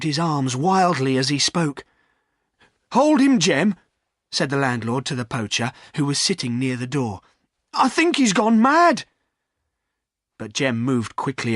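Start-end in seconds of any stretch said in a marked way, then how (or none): 2.9-3.7 high, loud command; urgent order. Acted. Landlord ordering Jem to hold Silas, loud. 7.7-9.0 high, loud alarm; he's lost his mind. Acted. Landlord warning Jem about Silas, loud.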